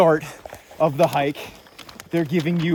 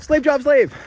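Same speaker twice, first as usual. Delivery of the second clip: mock indignant yelp; 10 semitones higher, 7 dB louder than usual. Mock outrage at friend's job.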